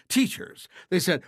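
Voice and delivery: ordinary delivery, nothing marked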